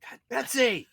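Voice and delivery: high, loud exasperated yell at his wife; retold yell. Husband yelling at wife over the wrong soda, loud and high. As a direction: snap her name out in sudden frustration. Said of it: "I yelled at her".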